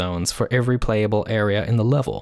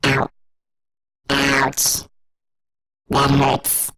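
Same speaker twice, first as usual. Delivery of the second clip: processed robot voice, pitched down; hurt robot voice with a raised formant; much slower than usual. Shown as a demonstration. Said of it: "creating a robot voice".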